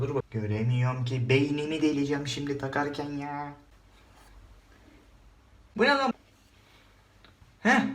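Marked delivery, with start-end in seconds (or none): 5.8-7.8 high sudden startled yelp. Real, not acted. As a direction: jolt as if something stabbed. Device pokes head, shocked.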